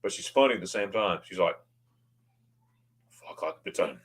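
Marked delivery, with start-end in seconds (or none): 3.4-4.0 lightly imitated curt German conductor, low and softer; mock-serious, low ticket checker; serious ticket inspector. Passenger imitates the train's ticket lady, serious. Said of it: "she's all serious".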